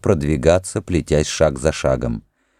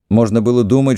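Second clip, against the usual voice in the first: ordinary delivery, nothing marked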